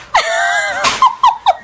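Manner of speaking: high, bursting laugh. Real, not acted. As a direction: let out a big delighted laugh. Laughs at the reveal.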